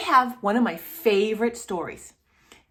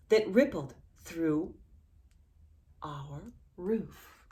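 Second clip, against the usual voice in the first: put-on reading to children. Reading the picture book aloud to a child, gentle.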